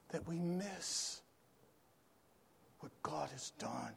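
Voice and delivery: hushed, breathy whisper of the line; dropping to a whisper. Shown as a demonstration. Preacher whispers the repeated line to the congregation. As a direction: let it fall to a whisper so they lean in.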